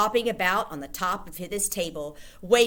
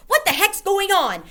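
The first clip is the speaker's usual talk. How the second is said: put-on much higher, louder boy voice; puzzled boy; 14 semitones higher, 9 dB louder than usual. A narrator's reading. Bruno asking the witches what is happening. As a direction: ask it like a baffled kid. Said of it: "Bruno asked".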